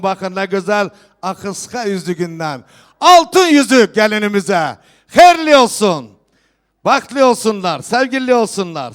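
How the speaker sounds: loud festive proclamation to the hall; turkish wedding announcer. Real, not acted. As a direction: call out each gift to the guests. Host proclaims gifts at wedding.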